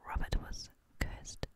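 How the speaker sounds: plain reading voice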